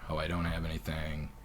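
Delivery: usual speaking voice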